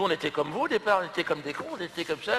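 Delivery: ordinary delivery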